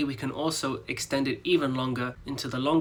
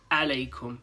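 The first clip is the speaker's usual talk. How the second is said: ordinary talk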